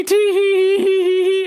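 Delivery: high mock tee-hee, drawn out slow; giggle. Worker mimicking customer's giggle, high and slow.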